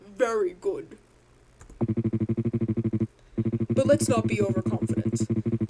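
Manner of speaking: very deep cartoon villain; low menacing villain boom. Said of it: "cartoon villain voice".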